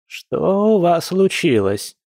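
slow, high-pitched puzzled granny; bewildered. A narrator's reading. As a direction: ask in drawn-out bewilderment. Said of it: "недоумённо спросила бабуля".

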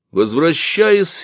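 ordinary delivery, nothing marked

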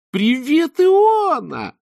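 very high, loud squealed greeting; faked surprised squeal; shrill squeal. A narrator's reading. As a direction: squeal it with fake delight. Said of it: "взвизгнула Берил".

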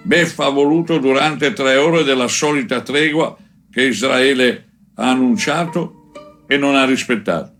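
usual speaking voice

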